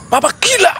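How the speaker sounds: shrill high outburst at papa; bratty shout. Shown as a demonstration. Daughter screaming at her father on set. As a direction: yell it like a rude teen.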